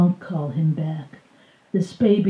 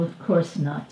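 ordinary delivery

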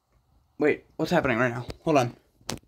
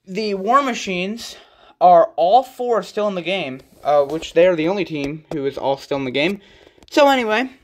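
excited sports-announcer voice, a bit higher and louder; hyped announcer; 3 semitones higher, 3 dB louder than usual. Acted. Kid presenting toy monster truck standings, announcer style.

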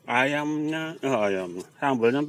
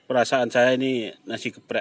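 ordinary talk, nothing marked

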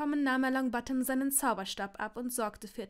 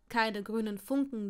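usual speaking voice

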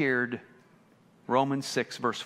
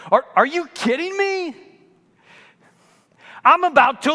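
higher, louder outraged disbelief; Paul shouting his disbelief, higher and louder; outraged exclaiming; 10 semitones higher, 7 dB louder than usual. Retold or imitated. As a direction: blow up like you can't believe it. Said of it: "Paul says".

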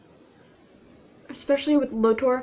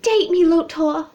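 loud, raised attempt at a British accent; badly faked British accent, pushed loud and high; Laura's accent, attempted; 4 semitones higher, 7 dB louder than usual. Mimicking a friend courting Lotor, poor British accent. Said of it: "I can't do an accent".

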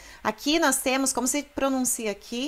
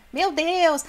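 high, loud baffled learner; mock dismay; 8 semitones higher, 7 dB louder than usual. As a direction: play up the confused student's panic. (espanto fingido)

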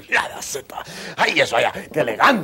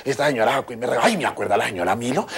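usual speaking voice

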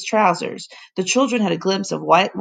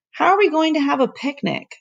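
put-on boy's voice; 8 semitones higher than usual. Boy questions the professor.

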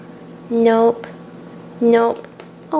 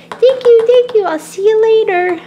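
excited thanks; 9 semitones higher, 5 dB louder than usual. Acted. Child thanking parents excitedly.

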